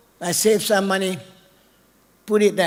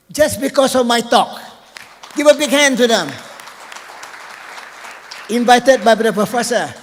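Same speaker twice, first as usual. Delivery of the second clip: loud, higher-pitched proud announcement; rallying applause; 4 semitones higher, 5 dB louder than usual. Real, not acted. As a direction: call out to the crowd for a big hand. Calls on audience to applaud visiting guests.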